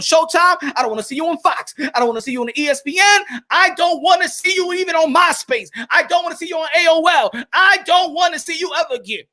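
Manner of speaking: fed-up ranting shout; escalating rant. Real, not acted. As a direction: build the rant to a yell. Escalating rant against Rigondeaux.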